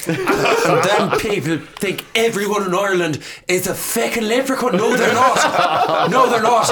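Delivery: put-on indignant Irish voice; Irish accent. Shown as a demonstration. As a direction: protest as an exasperated Irish local. Performs Irish character in accent demo.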